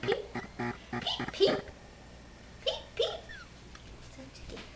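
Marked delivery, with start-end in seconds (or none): 1.0-3.2 high squeaky chick peeps; peeping baby chick. Pretend. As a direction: chirp like a tiny chick. Grandma voicing chick sounds.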